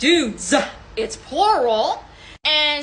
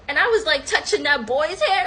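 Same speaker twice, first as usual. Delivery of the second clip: ordinary talk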